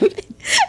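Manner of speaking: a quick high burst of laughter; high giggle. Real, not acted. Friend describing the slap, high giggle. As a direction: giggle mid-sentence.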